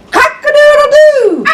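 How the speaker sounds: high crowing rooster call. Pretend. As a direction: crow it out like a rooster. Rooster sound in picture book.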